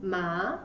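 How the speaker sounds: "Ma" sweeping upward in pitch; second tone. Shown as a demonstration. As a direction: say "Ma" in Mandarin second tone, rising to the end. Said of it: "It goes up".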